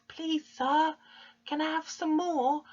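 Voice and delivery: high, small begging voice; Oliver Twist pleading. Acted. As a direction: beg timidly in a high little voice. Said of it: "And Oliver pleads".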